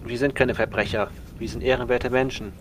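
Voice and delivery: ordinary talk, nothing marked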